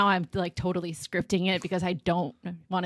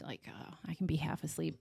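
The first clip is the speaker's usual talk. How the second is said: soft whisper; 2 semitones lower, 13 dB quieter than usual. Real, not acted. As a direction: whisper it half asleep. Describing being half asleep.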